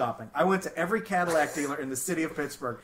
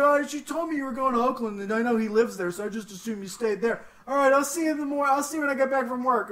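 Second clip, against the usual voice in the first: lightly voiced mom, higher and brisk; chirpy mom brushing it off, faster near the end; 9 semitones higher, 4 dB louder than usual. Mom voiced in retold story.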